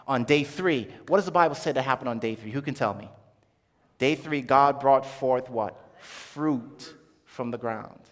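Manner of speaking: usual speaking voice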